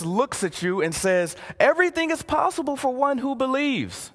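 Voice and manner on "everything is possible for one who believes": Jesus's calm reply. Acted. Jesus answers the father.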